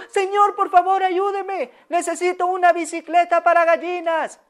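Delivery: high, loud plea to the street; calling out. Acted. Owner calling out loud and high to the passing vendor. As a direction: call after the vendor, pleading. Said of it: "salió".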